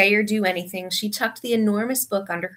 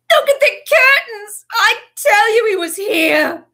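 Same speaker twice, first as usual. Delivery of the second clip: high, loud, slow desperate insisting; frantic shout; 14 semitones higher, 9 dB louder, slower than usual. A narrator's reading.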